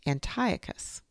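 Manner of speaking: usual speaking voice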